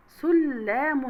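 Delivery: slow سلام said "sul-lamun", leaning on the double l; doubled l. Shown as a demonstration. Teacher showing shadda, سلام as slow "sul-lamun" with held l. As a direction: hold the l in سلام across two syllables. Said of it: "الحرف المشدد هنا".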